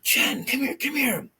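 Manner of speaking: high-pitched, excited call; urgent calling out. Retold or imitated. Man calls his sleeping girlfriend to the washroom, urgent call. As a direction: call her over urgently, voice way up.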